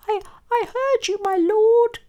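very high, hesitant squeak; timid mouse. A narrator's reading. Mouse arrives to help the lion, high squeak. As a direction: stammer it in a tiny mouse voice.

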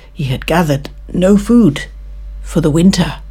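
ordinary delivery

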